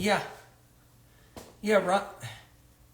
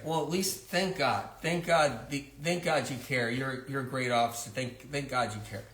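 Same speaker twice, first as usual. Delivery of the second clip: lower, softer mock gratitude; sarcastic thanks; 5 semitones lower, 5 dB quieter than usual. Mother sarcastically thanking the police officer, low and soft. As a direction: drip sarcasm as you thank him.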